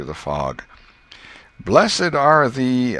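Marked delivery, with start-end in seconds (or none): 1.6-3.0 high, loud, slow sing-song recitation; a boy dragging out half-learned verses; halting schoolboy recital. Acted. Tom stumbling through his verses for Mary, high and slow.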